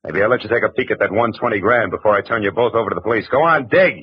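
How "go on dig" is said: sharp command, pushed up and louder; barked order. Acted. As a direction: order him to dig. Marlowe orders Dietrich at gunpoint.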